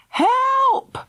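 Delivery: high, loud scream for help. A narrator's reading. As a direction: scream it with everything. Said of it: "she screamed as loud as she could".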